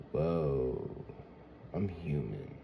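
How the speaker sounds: low, soft moment of awe; subdued, humbled wonder; hushed realization. Acted. Voices the realization after crying.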